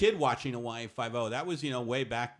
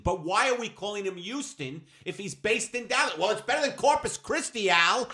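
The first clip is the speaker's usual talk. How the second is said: higher-pitched mock writers' argument; penulis TV berdebat; 7 semitones higher than usual. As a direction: act out exasperated writers batting ideas around. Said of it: "I could see the writers just pacing".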